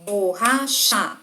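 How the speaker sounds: BORRACHA in three stretched beats, "bor - ra - cha"; dividido em sílabas. Shown as a demonstration. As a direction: say borracha syllable by syllable. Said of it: "uma palavra é maior que a outra". (borracha separada em sílabas, bor... ra... cha)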